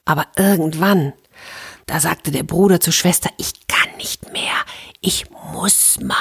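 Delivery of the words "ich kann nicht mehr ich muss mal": breathless whisper, slow and pleading; boy whispering he needs to go. A narrator's reading. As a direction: hush it so the robbers below can't hear. Brother urgently needs to pee.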